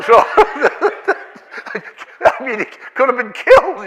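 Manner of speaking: helpless laughter in the words; high, loud and laughing; real laugh running through the sentence. Real, not acted. Still laughing at the story.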